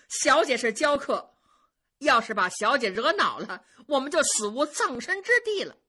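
high, loud, eager protesting; effusive, emphatic appeasing; ingratiating, smiling. A narrator's reading. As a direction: plead innocence to a superior with a smile. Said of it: "全都笑着说".

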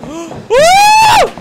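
very high, loud "ooh" shriek; real scream at a sudden scare; startled scream. Real, not acted. Jump scare in horror game.

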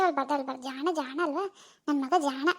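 low, very soft comforting; calm a crying baby softly; hushed soothing. Acted. (ಮೆಲ್ಲನೆ, ತಗ್ಗಿದ ದನಿಯಲ್ಲಿ ಸಂತೈಸುವುದು)

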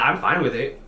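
ordinary delivery

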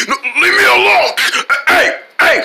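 high, hammed-up cry; high-pitched protest. Bothered character to attacker, high protest. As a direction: yelp it to fend someone off. Acted scuffle, fending off attacker.

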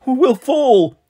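high, loud frightened cry; scared child. A narrator's reading. Small boy to sister in glass pod, crying out afraid. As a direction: cry out like a panicking little boy.

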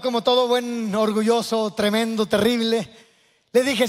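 ordinary delivery

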